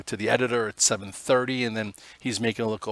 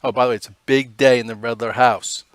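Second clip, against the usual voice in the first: ordinary talk, nothing marked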